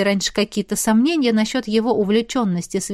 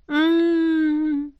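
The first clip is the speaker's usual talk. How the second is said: long, high hum of pleasure; savoring "mmm", held high; purring hum; 8 semitones higher than usual. A narrator's reading. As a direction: hum in anticipation of something sweet. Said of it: "замурлыкала я".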